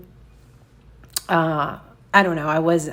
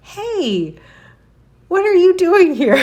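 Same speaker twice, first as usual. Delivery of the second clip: laughing greeting; 12 semitones higher, 7 dB louder than usual. Retold or imitated. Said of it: "So I said".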